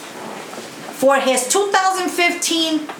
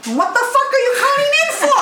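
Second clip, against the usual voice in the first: what the fuck, fired off fast and high; exasperated outburst; 10 semitones higher, faster than usual. Real, not acted. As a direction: blurt it out in exasperation.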